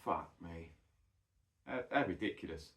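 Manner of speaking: quietly disbelieving inside his head; inner thought. Retold or imitated. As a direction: private thought, low and soft. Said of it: "I'm thinking to myself".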